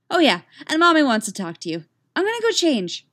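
put-on quick, high little-kid voice; excited child. A narrator's reading. Katara passing on Mommy's message, hurried and excited.

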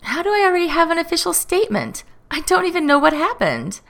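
high-pitched, whiny objection; indignant protest. A narrator's reading. Matthew objects to the statement, indignant.

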